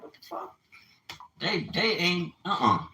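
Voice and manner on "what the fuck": whispered disbelief. Retold or imitated. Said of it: "I used to look at them like".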